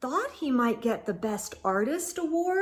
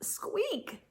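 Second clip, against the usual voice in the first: tiny high squeak, much softer; 9 semitones higher, 10 dB quieter than usual. Acted. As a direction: squeak it out like a frightened mouse. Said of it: "all that came out was a".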